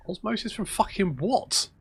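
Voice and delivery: high, baffled disbelief; incredulous. Real, not acted. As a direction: pitch up in total confusion. Baffled by odd map corruption.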